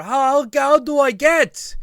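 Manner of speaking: the hostel guy yelling over to the party, loud and high; yelled question. Retold or imitated. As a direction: shout the question across the gap. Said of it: "he yells over".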